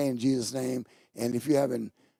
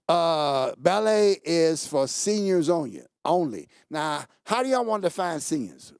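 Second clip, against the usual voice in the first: ordinary delivery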